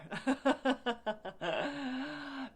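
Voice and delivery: long, high burst of laughter; real laugh. Real, not acted. Host amused by her viewers online, long real laugh. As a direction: laugh out loud in a rapid run.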